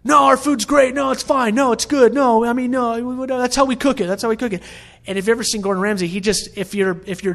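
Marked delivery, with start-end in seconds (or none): 0.0-4.6 high-pitched, protesting mock excuses; imitated defensiveness. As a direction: play an owner who won't hear criticism. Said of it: "this son is so defensive".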